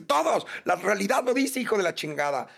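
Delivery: high, heated mock rebuke; regaño fingido. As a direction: fire back in a staged argument. Acted argument over limits of love. (regaño exaltado y agudo, actuado)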